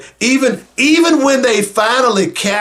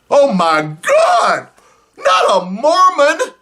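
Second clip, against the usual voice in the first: mock disbelief; 5 semitones higher, slower than usual. Real, not acted. Mock exasperation at the governor.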